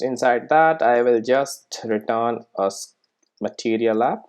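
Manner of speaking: ordinary talk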